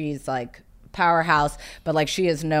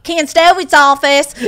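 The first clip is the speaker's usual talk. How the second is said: slow, higher, louder Southern phone greeting; thick South Carolina accent; 9 semitones higher, 10 dB louder, slower than usual. Retold or imitated. Young assistant answering the agent's phone, thick South Carolina accent. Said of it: "with the accent".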